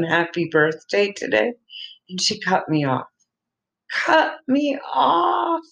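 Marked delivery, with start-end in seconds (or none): 3.9-5.6 high, slow, wailing "cut me off"; mock outrage. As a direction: ham up the hurt indignation.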